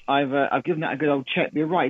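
normal talking voice

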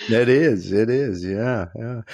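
usual speaking voice

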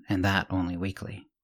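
ordinary delivery

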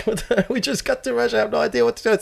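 high, bewildered squeak of panic; mock panic. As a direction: sound lost and freaked out. Panicked quote in Russia.

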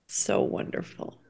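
soft breathy whisper of being moved; hushed so wonderful. Real, not acted. Friend moved by the earrings story, whispered. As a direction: breathe it out, touched.

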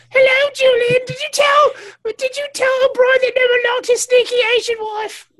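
very high, loud shrill mother voice; a mother scolding her son, way up high; Julian's mom. Retold or imitated. A mother scolding Julian for insulting O'Brien's wife, very high. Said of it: "That's his mom".

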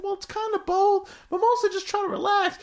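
high, eager cartoon helper voice, laughing through it; Mister Meeksy voice. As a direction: squeak it up like a chipper little helper who exists to serve. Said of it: "He's like".

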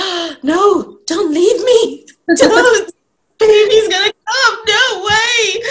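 loud, high, hammed-up wail; panicked pleading. Wife in labour begging husband to stay, mock panicked plea. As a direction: beg in mock panic, stretching the words out.

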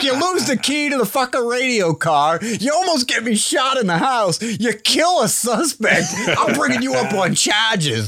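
high, far-off cop tirade, laughing at the end; ranting cop. Retold or imitated. Host voicing the cop listing the partner's blunders, high and outraged. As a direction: list his partner's sins like an outraged cop. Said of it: "It's like".

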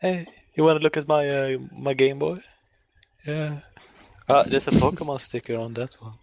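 fast, bored dinner-guest voice; Adam Owen impression. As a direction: rattle it off like a dull guest. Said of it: "he's just saying".

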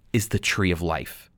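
ordinary talk, nothing marked

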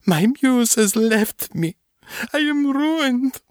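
high, slow, anguished wail; tortured artist. A narrator's reading. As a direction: despair of a broken artist. Despairing composer voiced by narrator.

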